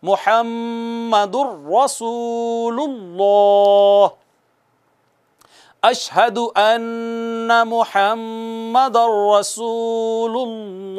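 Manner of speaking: रसूलुल्लाह recited slowly with a full, heavy लाम; drawn-out recitation, the lam in रसूलुल्लाह thick. Shown as a demonstration. Teacher reciting the shahada, heavy lam drawn out. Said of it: "मोटा करके पढ़ना है".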